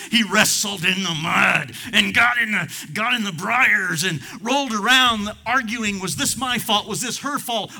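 high, loud impassioned preaching; preaching at full force. Acted. Preacher voicing a man's anguished self-questioning, shouting. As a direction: pour it out over the congregation.

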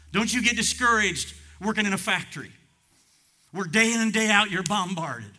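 high, loud sermon delivery; full-force preaching. Real, not acted. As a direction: proclaim it to the back pew.

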